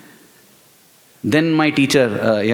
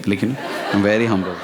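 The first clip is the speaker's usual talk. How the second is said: mock humble; 8 semitones lower, 3 dB quieter, faster than usual. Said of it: "mock humble".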